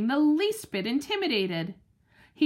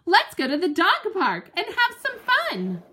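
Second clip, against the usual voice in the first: eager bark; 6 semitones higher, 3 dB louder than usual. Acted. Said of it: "I bark".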